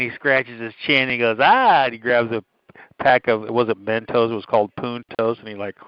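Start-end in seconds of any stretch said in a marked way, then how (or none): 1.4-1.8 mock realising ah. Acted guy realising.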